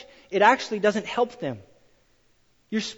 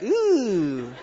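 ooh. Retold or imitated. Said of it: "I was like".

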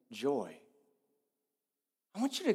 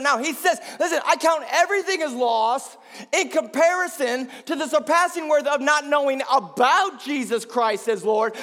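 loud, high-pitched pulpit proclamation; full-force preaching; 9 semitones higher, 8 dB louder than usual. Acted. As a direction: preach the verse out to the whole room.

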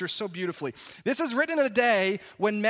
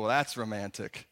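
much lower, softer deadpan sarcasm; sarcastic; 10 semitones lower, 5 dB quieter than usual. Retold or imitated. A congregant mocking the verse, low and sarcastic. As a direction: drop it low and dry.

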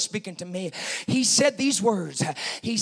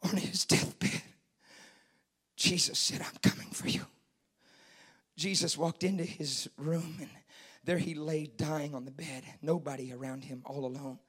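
low, very soft deathbed story; solemn, lowered voice with long pauses; hushed preaching; 6 semitones lower, 11 dB quieter than usual. Real, not acted. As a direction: tell it gravely, almost whispering at times.

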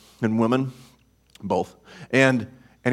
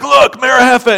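excited; 10 semitones higher, 5 dB louder than usual. Retold or imitated. Excited preacher quoting himself.

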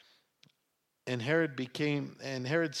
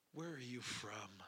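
very soft, barely voiced question; afraid, hushed; 3 semitones lower, 18 dB quieter than usual. A narrator's reading. Pilate, more afraid, questions Jesus, hushed. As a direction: drop to a near whisper.

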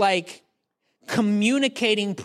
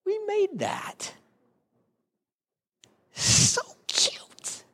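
tiny high squeal sinking to a whisper; hushed, drawn-out marvelling at your own child; whispered, besotted cooing; adoring parent; 13 semitones higher, much slower than usual. Acted. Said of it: "look at your kid and you're like".

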